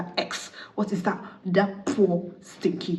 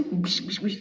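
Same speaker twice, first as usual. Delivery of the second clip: unvoiced whooshing hit noises; imitated beating blows; 4 dB quieter, slower than usual. Narrator acting out the groom's blows, imitated beating. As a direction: make quick slapping sound effects.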